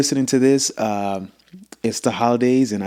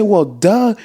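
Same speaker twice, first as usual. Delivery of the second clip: pitched up, loud retelling; mock loud high voice; 11 semitones higher, 7 dB louder than usual. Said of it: "I'm like".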